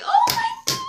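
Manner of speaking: very high, loud squeal of excitement; fan squeal. Real, not acted. Fan reacting to Stiles on the radio, high thrilled shriek. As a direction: shriek it like your favourite character just came back.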